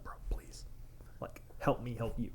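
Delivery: pleading under the breath, barely voiced; whispered plea. Tattoo artist begging the client to cooperate, in a pleading whisper. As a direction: hush it like a desperate aside.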